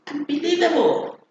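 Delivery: high, arching cry of disbelief; disbelieving exclamation. Shown as a demonstration. Teacher summing up exclamation examples, disbelieving. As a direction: exclaim it with astonished emphasis. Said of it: "Such kind of expressions".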